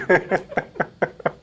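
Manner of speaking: airy unvoiced laugh; breathy laugh. Real, not acted. Lecturer to class, breathy laugh at own joke.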